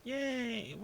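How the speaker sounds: squealed high yay; high cheer. Acted. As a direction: cheer like a delighted girl. Misha cheers.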